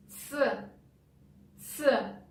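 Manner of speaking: ц said alone, a short clean ts sound; just the ц, crisp and short; isolated ц. Shown as a demonstration. Teacher isolating a sound for learners. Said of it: "Here we pronounce ц".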